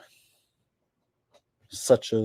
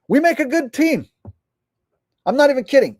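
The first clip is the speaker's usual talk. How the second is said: excited; 8 semitones higher, 4 dB louder, much faster than usual. Real, not acted. Excited praise to fans.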